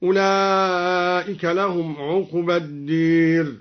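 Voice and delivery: "الدير" with the long a tilted to a long e; slow recitation, "الدير" said as ad-deer; imala on الدار. Shown as a demonstration. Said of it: "وأمال الدار من قوله تعالى". (إمالة ألف "الدير" نحو الياء)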